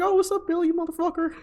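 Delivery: high-pitched, fast, mocking phone taunt; squeaky cocky jerk needling his rival; smug rival on phone. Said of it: "And he's like".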